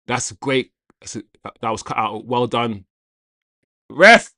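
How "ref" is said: loud, high shout at the referee; calling out. Real, not acted. Fan appealing to the ref, shouted protest. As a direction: yell it sharply up at the official.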